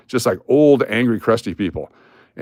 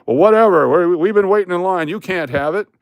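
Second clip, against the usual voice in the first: higher, louder mock indignation; irritated retort; 8 semitones higher, 4 dB louder than usual. Man in line arguing with seated old lady, irritated. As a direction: imitate a customer snapping back in a quarrel. Acted quarrel over cutting in.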